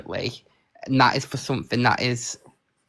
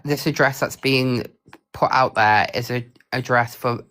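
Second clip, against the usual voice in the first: normal talking voice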